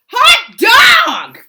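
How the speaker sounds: high, loud cheer; cheering cry. Acted. Class cheering that the principal is gone, loud high cry. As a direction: shout it out with glee. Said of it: "cried the class".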